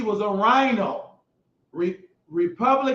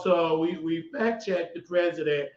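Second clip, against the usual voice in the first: lower, slower mock newsreader drone; lightly voiced boring anchor, slower; 5 semitones lower, 4 dB quieter than usual. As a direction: parody a dull TV anchor. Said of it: "They want you to talk real slow".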